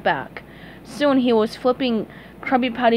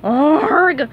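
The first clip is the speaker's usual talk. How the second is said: loud frustrated grumble; fed up and exasperated; grumpy squid's groan of despair; 5 semitones higher, 6 dB louder than usual. A narrator's reading. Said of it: "Squidward groaned".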